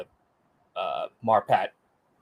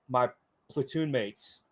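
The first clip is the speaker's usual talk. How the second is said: ordinary talk, nothing marked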